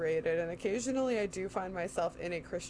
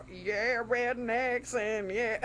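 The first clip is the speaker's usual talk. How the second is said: mock country drawl; 3 semitones higher, much slower than usual. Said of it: "a country song that was truly like".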